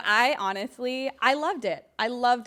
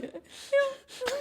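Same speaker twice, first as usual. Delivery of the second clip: tearful yeah. Retold or imitated. Crying after the cane shot.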